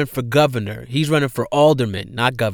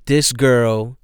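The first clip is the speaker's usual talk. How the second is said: ordinary delivery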